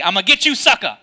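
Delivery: light Mister T growl of a threat. Retold or imitated. Preacher quoting Mister T as a lightly voiced tough-guy threat. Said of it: "In the words of Mister T".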